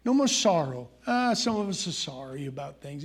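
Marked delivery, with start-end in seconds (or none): none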